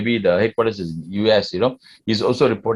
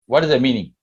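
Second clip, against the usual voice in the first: ordinary talk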